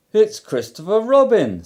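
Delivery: light up at the sight of him; eager. A narrator's reading. Pooh recognising Christopher Robin, eager. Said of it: "said Pooh eagerly".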